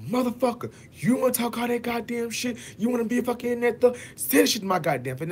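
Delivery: imitating an aggressor, high and loud; high-pitched mock tirade, spoiling for a fight; angry confrontation. Retold aggressor cussing him out.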